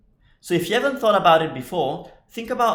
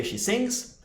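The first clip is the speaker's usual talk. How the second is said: "she sings" lifted higher and soft; correct form, higher; 7 semitones higher, 5 dB quieter than usual. Shown as a demonstration. Teacher giving students the correct form, pitched up. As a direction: brighten up on the right form. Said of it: "we say".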